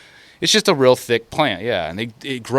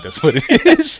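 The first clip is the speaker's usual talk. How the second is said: laughing; 7 dB louder than usual. Real, not acted. Laughing at own claim.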